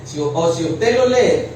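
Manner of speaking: ordinary talk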